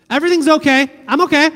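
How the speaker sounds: high, loud, forced-bright "I'm okay"; mock cheery "I'm okay". Youth pastor mocking a peace-faker's brave "I'm okay". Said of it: "With that tone and inflection?".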